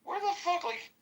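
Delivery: high-pitched incredulous whine; retelling the mall trip in a squeaky voice of disbelief; exasperated high squeak. Retold or imitated. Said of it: "And I'm like".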